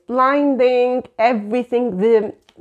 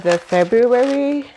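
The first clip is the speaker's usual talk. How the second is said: ordinary talk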